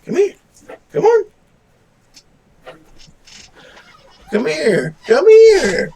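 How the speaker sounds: high-pitched coaxing dog call. Real, not acted. Calling the dog over to camera.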